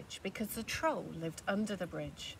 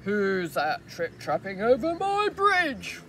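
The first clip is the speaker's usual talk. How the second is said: much louder, slow troll roar; 11 dB louder, slower than usual. Acted. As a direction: bellow over the bridge. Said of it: "roared the troll".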